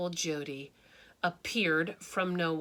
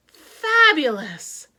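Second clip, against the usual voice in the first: high, loud, slow gush; delighted exclamation; 7 semitones higher, 13 dB louder, slower than usual. A narrator's reading. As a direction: savour the word with delight.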